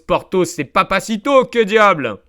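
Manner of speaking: loud, high, boisterous cry; swashbuckling exclamation. Acted. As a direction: declare it like a musketeer. Exclaiming Porthos-style.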